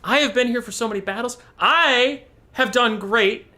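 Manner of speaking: high, whiny mammoth complaining; fed-up Timmy. Retold or imitated. Timmy the mammoth complains to the crew, high and indignant. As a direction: wail it like a sulking hero. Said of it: "Timmy's like".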